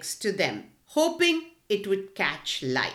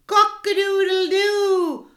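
much higher, loud crowing; rooster crow; 10 semitones higher, 7 dB louder than usual. A narrator's reading. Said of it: "crowed the cockerel".

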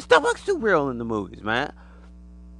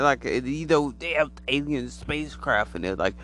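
ordinary talk, nothing marked

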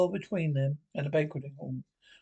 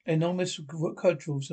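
ordinary delivery

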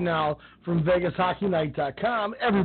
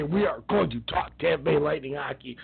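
usual speaking voice

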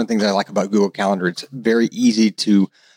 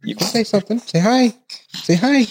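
high, sing-song coaxing voice; 12 semitones higher than usual. Real, not acted. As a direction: coax a little one to say hi. Coaxing a baby to speak.

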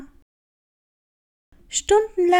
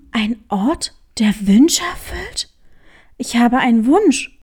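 eager hare; 5 semitones lower than usual. Acted. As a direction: echo it back in wonder. Hare echoes, excited. (staunend, "Wünsche" steigend gefragt)

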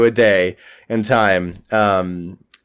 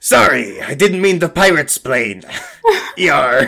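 pirate; 4 semitones higher than usual. Acted. Pirate figure apologising to crew.

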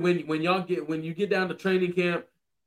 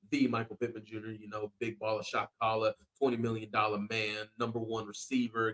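low, hushed roll call of titles; understated hype-man intro, pitched low; mock-grand introduction; 7 semitones lower, 10 dB quieter than usual. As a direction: drop lower and softer to list his titles. Repeats name as a hype introduction.

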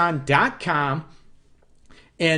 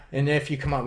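usual speaking voice

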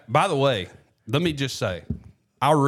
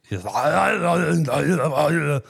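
drawn-out mock accented grumbling, pitched up; grumbling Arnold; 5 semitones higher, 4 dB louder, much slower than usual. Co-host mocking the actor's thick accent, grumbling. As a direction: garble it into a long grumble.

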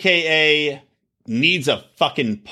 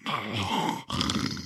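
heavy open-mouthed breath; 6 semitones lower, 12 dB quieter than usual. Retold or imitated. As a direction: imitate a slack mouth breather, audibly. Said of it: "He sits there the entire time like this".

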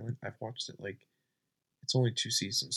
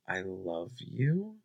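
normal talking voice

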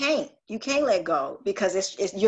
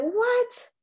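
surprised what. Retold or imitated. Amazed reaction to sign.

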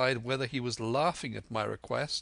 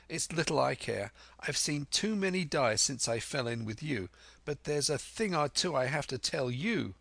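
put-on higher, spirited young voice; Jim, defiant; 6 semitones higher than usual. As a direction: stand up to the pirates, pitched up.

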